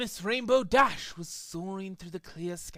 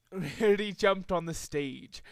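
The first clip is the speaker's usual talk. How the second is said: plain reading voice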